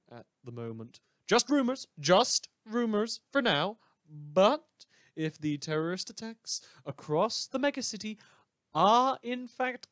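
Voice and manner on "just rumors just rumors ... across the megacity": higher, louder eager hype; can barely hide the thrill; excited. Acted. Host hyping war rumors eagerly.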